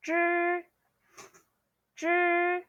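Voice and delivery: "zhi" curled-tongue, high and level; pronunciation model. Shown as a demonstration. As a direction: hold "zhi" like a high, even "jr". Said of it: "you say".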